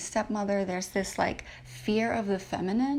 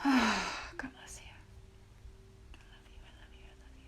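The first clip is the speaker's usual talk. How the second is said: tearful whisper; 7 dB quieter than usual. Real, not acted. Whispering love to her late grandmother, tearful. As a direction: barely breathe the words through tears. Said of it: "I knew this would get emotional".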